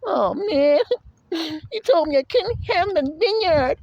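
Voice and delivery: high mock whimper of a sulking king; mocking a crybaby king, high whining sob; whiny king. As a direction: whine it like a spoiled brat crying.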